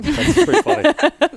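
laughing through the words; can't hold back a laugh. Real, not acted. Guest laughing at the silly fake name John Jodperson.

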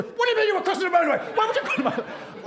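very high, rapid-fire squawk down the phone; freaking-out mum. Retold or imitated. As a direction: imitate a mother losing it, racing and sky-high. Angry mum heard over the phone.

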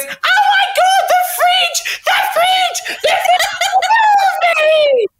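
very high hysterical squeal; piercing high playful scream; mock screaming. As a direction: shriek it out, hammed-up. Acts out screaming on the episode.

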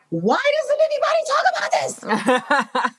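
high, laughing disbelief at a hidden gem; amazed exclamation. Retold or imitated. Visitor marveling at South African wineries, high amazed laugh. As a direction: squeal it with delighted surprise.

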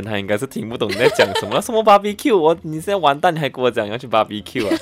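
loud, laughing mock disbelief; amused and incredulous, voice lifted. Real, not acted. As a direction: tease through bursts of laughter. Teacher laughs at co-host's joke.

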